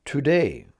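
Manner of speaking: "today" with a full "too" at the start; full "to" pronounced. Shown as a demonstration. Teacher shows how others pronounce the two, "today" as "too-day". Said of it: "do their best to pronounce the two".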